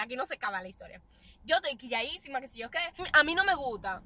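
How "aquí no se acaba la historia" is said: dropped low and soft; quiet low. Shown as a demonstration. Vlogger repeating her line, quiet and low. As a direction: pull it back down, almost to herself.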